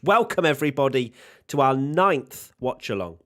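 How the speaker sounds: loud, high announcer-style greeting; host's big welcome. Real, not acted. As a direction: welcome the whole audience, projecting and drawing it out.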